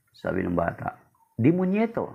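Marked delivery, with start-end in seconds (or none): 1.4-2.1 put-on higher, childlike reply; child's blunt answer. Child tells the priest his name, higher and bold. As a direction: say the name proudly like a small boy.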